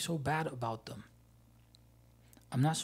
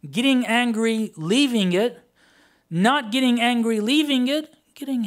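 loud, raised preacher's voice; impassioned preaching; 7 semitones higher, 8 dB louder than usual. Acted. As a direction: preach it forcefully, each option driven home. Preaches at full force.

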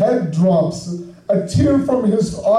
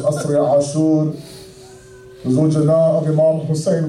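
normal talking voice

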